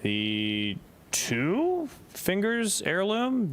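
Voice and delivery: slow, stretched phrase; drawn out. Real, not acted. Drawn-out selection.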